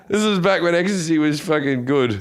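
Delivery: ordinary talk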